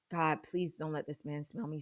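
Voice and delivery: hushed prayer. Retold or imitated. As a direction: pray under your breath, pleading. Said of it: "And I'm praying like".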